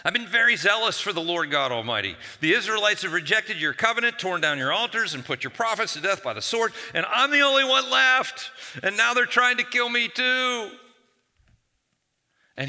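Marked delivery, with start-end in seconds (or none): 0.0-10.8 high, loud, whining grievance; rising into a loud whiny wail; whiny complaint. Acted. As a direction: whine it out to God like a wronged prophet. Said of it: "see if you can hear the whininess in his voice".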